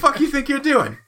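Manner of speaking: hammed-up cockney scolding; British bobby voice. Host mocks British listeners in a loud, high British accent. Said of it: "my terrible British accent".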